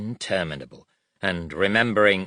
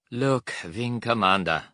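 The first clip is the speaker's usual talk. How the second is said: put-on weary German-accented officer, slightly raised; 4 semitones higher than usual. A narrator's reading. German guard appeals to difficult prisoner, wearily. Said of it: "the German said wearily".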